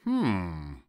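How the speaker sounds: drawn-out pondering hum; musing to himself. A narrator's reading. Fox considering the piglet's suggestion, musing. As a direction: hum it while weighing the idea. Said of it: "the fox said to himself".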